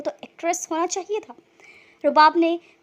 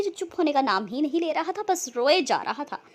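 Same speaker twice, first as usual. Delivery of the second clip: usual speaking voice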